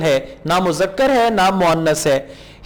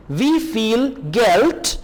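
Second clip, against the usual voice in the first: slow model reading; much slower than usual. Shown as a demonstration. Slow German model phrase.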